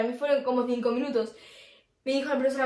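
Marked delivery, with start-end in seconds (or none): none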